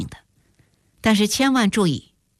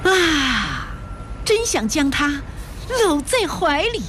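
high-pitched, emotional outpouring; deeply moved; 7 semitones higher, slower than usual. A narrator's reading. Juan'er spreads her arms before the supermarket, deeply moved. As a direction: gush with tender longing, voice lifted high. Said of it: "动情地说".

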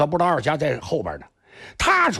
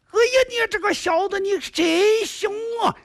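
exasperated shout. A narrator's reading. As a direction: yell it out, stung and furious. Hero coughing in the powder smoke. (二侠被迷目杀呛着，气急高喊)

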